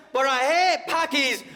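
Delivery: high slow shouted hate; mock shout. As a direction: yell "hate" in a hammed-up rant. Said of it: "be like".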